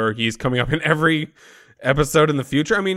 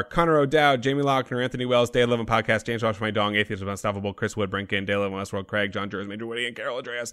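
fast, run-together list of names, dropping softer; rapid-fire; 5 dB quieter, faster than usual. Real, not acted. Podcast host thanking patrons by name, rapid-fire. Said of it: "You say those so fast every time".

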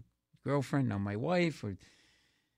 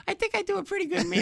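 very high, quick squeaky impression; fast high comic celebrity voice; Jay Leno; 3 dB louder, faster than usual. Comic as Leno answering the insult, squeaky impression. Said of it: "and he's like".

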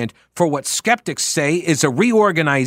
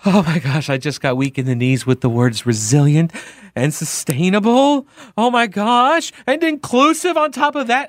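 gushing mock rapture, climbing higher and higher; mock swooning; 3 semitones higher than usual. Commentator mocking lefties who love buzzwords, gushing mock swoon. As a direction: swoon over each buzzword, pitch rising as it builds.

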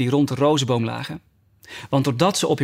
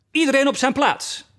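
very high-pitched calling order; thundering royal command; 12 semitones higher than usual. A narrator's reading. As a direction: call everyone to order, pitch soaring. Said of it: "riep de koningin met donderende stem". (donderend bevel op hoge toon)

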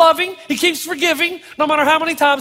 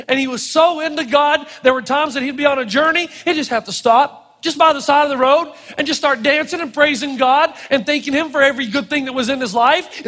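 normal talking voice

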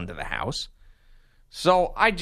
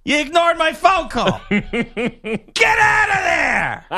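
high, very slow, louder scolding; angry voicemail; 14 semitones higher, 4 dB louder, slower than usual. Retold or imitated. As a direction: drag it out furiously. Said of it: "And she'll call right back".

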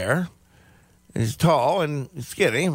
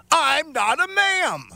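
high, slow, indignant correction; high mocking clerk voice; 11 semitones higher, slower than usual. Clerk correcting the customer, high and indignant. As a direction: squeak it out like the offended clerk. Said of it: "And the voice replied back".

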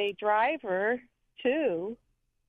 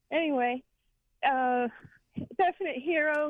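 ordinary talk

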